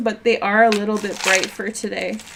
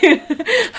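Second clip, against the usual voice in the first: high laugh; 9 semitones higher than usual. Real, not acted. Laughing at own Borat.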